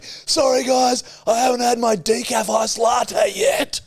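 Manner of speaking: high, slow hammed-up shout; mock shouted apology. An arsonist shouts apologies to burning victims, hammed up. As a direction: yell it while pretending to burn. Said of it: "They're all on fire saying".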